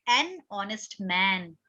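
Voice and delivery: slow, careful example phrase; very slow demo. Shown as a demonstration. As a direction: draw out An honest man for the class. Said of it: "इसलिए यहाँ पर क्या होगा?".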